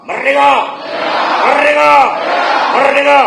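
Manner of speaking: shouted rally cry; rally speech. Real, not acted. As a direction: belt out the chant to the crowd. Leader leads rally chant.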